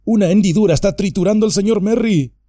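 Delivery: high, fast cry of alarm; urgent yell for help; frantic shout. Acted. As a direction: blurt it out in panic. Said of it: "gritó Sam".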